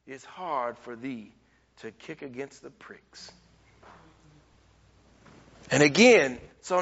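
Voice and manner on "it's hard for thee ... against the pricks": low, very soft, hushed divine voice; voice of the Lord. Acted. Preacher reading the Lord's answer to Saul, low and hushed. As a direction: speak it low and gentle from above. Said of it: "And the Lord said".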